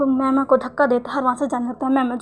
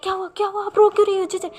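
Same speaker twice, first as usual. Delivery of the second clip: higher, anxious questions; alarmed, higher worried asking; 6 semitones higher, faster than usual. Acted. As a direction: ask in alarm why she cries. Sees JJ crying, worried.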